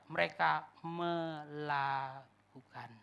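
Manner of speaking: "melakukan" stretched syllable by syllable; slow, drawn-out "melakukan"; deliberate emphasis. Real, not acted. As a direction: say "melakukan" very slowly, each syllable held.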